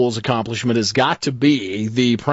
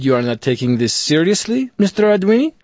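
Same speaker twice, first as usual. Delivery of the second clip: mock French Canadian official; 4 semitones higher than usual. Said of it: "I played my desperation card and I said".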